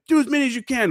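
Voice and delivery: high barked order; drill instructor order. Retold or imitated.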